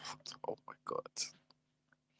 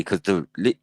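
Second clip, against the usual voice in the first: usual speaking voice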